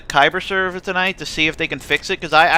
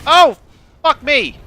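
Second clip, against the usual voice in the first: high startled yelp; startled shout; 10 semitones higher, 9 dB louder, slower than usual. Real, not acted. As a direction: jump and yell in shock. Startled in game.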